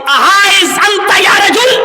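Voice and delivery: slow, high, loud Arabic shout; loud call. Retold or imitated. Umar shouting again at the man walking away, slow loud call. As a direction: bellow it after him, slowly. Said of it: "آپ نے پھر زور سے آواز دی".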